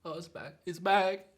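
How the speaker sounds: weak, strained with pain. Real, not acted. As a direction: groan the words out in pain. Suffering from the hot pepper.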